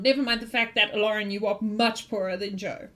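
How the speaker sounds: usual speaking voice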